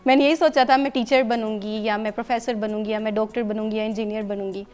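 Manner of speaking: usual speaking voice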